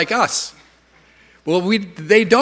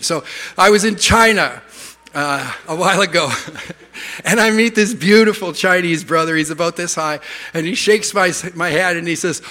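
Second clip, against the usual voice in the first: ordinary talk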